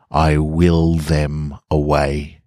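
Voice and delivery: plain reading voice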